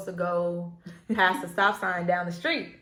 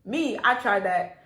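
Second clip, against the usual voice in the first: ordinary talk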